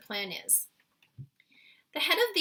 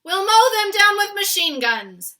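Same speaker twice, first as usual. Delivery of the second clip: high, very loud army bluster; cried out; 11 semitones higher, 18 dB louder than usual. Acted. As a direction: roar it like a warlike general. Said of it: "cried the head of the army".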